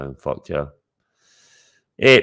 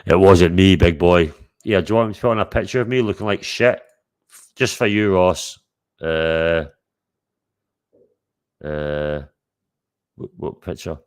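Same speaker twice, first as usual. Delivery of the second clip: usual speaking voice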